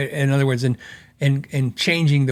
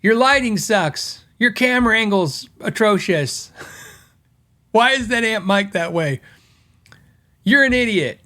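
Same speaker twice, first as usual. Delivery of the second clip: higher, sneering voice of a rude commenter; mock commenter; 6 semitones higher, slower than usual. A guitar tech mocks rude viewers' complaints in a sneering higher voice. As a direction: imitate a snarky YouTube commenter. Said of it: "people are like".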